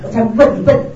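loud, acted-out retort; mock talking back. Interpreter voicing an employee answering the boss back, loud mock retort. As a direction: throw the insult back loudly, in play. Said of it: "你就回嘴".

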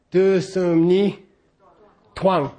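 "twang" said as one syllable after a slow "Du sum ni"; merged syllable. Shown as a demonstration. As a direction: squeeze twang into a single beat. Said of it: "You make it one syllable".

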